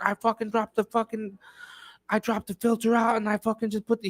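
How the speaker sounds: put-on sheepish young mechanic. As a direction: whine it out like you know you messed up. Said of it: "I was like".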